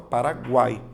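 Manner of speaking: "Paraguai" with the u sounded as w in gwai; U pronounced. Shown as a demonstration. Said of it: "a letra U, ela é pronunciada".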